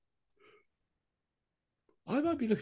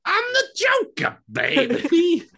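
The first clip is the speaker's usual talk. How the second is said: high, slow screeching Joker shout; 8 dB louder, much slower than usual. Pretend. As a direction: belt it like a manic Joker. Topping the Joker bit.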